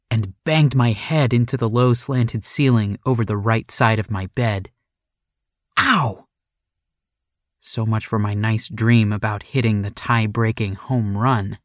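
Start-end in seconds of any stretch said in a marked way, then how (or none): 5.8-6.3 pained ow. Acted. Bangs head on ceiling.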